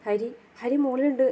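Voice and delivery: usual speaking voice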